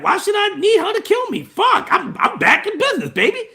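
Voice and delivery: cocky villain, back in business; Palpatine voice. Retold or imitated. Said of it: "And so it's like".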